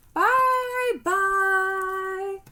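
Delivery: very high, loud, squeaky sing-song goodbye. Acted. A small bear calls goodbye to her, high and squeaky. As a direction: squeak it out like a tiny bear calling. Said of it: "a high squeaky voice calling to her".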